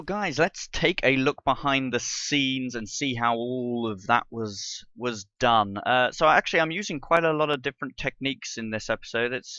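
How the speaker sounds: ordinary talk, nothing marked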